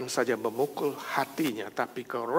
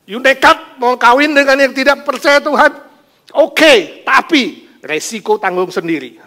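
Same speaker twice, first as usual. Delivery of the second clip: much higher, loud scolding; God shouting a warning at a stubborn believer; stern divine warning; 11 semitones higher, 14 dB louder than usual. Acted. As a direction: warn them sharply, like God himself.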